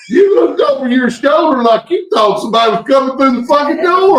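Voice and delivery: high, loud whoas tumbling into words; real high-pitched alarmed exclaiming; startled outburst. Real, not acted. Co-host reacts to host jumping at a knock, startled outburst.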